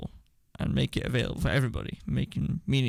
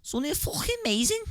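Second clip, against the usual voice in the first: high, hammed-up Scouse accent; overdone Liverpool accent, pitched up; 14 semitones higher than usual. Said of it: "You just put a Scouse accent on".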